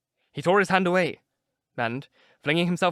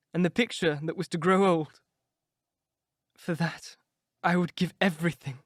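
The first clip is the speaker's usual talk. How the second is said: lightly raised, yearning voice of a vain youth; a mournful young man mourning his lost youth; wistful young Dorian; 4 semitones higher than usual. Acted. Dorian to his friends, mourning that he will grow old.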